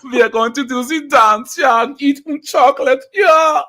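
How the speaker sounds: high, loud mock German accent; thick German accent. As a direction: ham up a German accent excitedly. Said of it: "The thick German accents".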